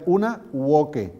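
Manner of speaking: "uoque" said as oo-OH-keh, V as U and hard K; classical Latin. Shown as a demonstration. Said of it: "aquí os pongo algunos ejemplos".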